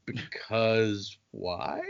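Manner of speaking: very slow, soft, puzzled question; drawn-out bafflement. Acted. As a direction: stretch each word out in confusion. Kelsier puzzled by the clue.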